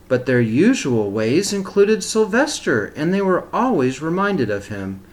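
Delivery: put-on reading a picture book aloud to children; steady, expressive storybook reading; story narration. A narrator's reading.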